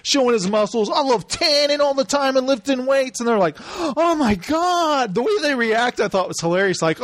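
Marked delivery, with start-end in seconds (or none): 0.9-3.2 higher-pitched mock meathead voice; hammed-up bodybuilder boast; boastful muscleman. Imitates muscle man's boasting. 3.7-5.1 high, long drawn-out horror; exaggerated scandalised gasp of words; mock shock. Imitates shocked reaction.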